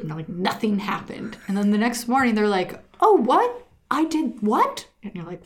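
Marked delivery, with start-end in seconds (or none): 3.0-4.9 high-pitched, clueless imitated questioning; mock bewilderment. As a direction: act baffled, pitch jumping up. Retold oblivious sleeper next morning.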